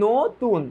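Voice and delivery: নতুন split as "no ... tun"; pronunciation shown. Shown as a demonstration. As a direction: sound out নতুন with a round o first. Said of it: "নতুনের উচ্চারণ তো".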